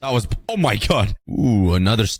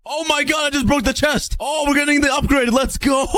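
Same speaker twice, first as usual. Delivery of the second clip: excited shout; 13 semitones higher than usual. Real, not acted. Player cheering when the upgrade drops. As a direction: whoop it out in triumph. Breaks chest, celebrating.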